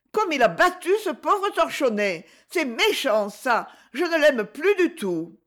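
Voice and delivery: put-on high child's voice, upset; a small boy hurt on his friend's behalf; indignant little boy.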